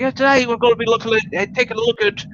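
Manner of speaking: put-on high, fast eager kid voice; enthusiastic kid reviewer. High fast eager voice imitating a kid reviewer's intro. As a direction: rattle it off like an excited kid. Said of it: "And he's like".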